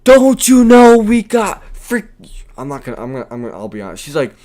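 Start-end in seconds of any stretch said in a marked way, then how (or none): 0.0-2.4 shrill, much higher and louder mock yell, slow; hammed-up road-rage shriek; screeching angry woman. Imitating the woman yelling from her truck, screeching. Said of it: "she's yelling like she's ready to fight".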